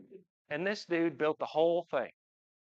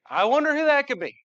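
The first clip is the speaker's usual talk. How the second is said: high mock-innocent sarcastic wonder, lifted far above his usual pitch; sarcastic quip; 13 semitones higher, 10 dB louder than usual. Dad joking to the employee, high sarcastic innocence. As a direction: play dumb about which kid it is, tongue in cheek. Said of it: "I'm like".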